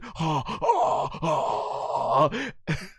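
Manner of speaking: long drawn-out mock gasp; hammed-up aghast intake; imitated shocked gasps. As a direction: gasp in stages like a devastated cartoon hero. Imitates Vegeta's devastated reaction.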